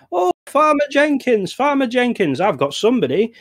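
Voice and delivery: high, eager call to Farmer Jenkins; mayor voice. Acted. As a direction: pitch it way up like an eager small-town mayor. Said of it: "And the mayor says".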